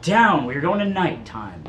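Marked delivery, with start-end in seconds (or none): 0.0-0.4 loud film set calls. Real, not acted. Moderator calls night phase.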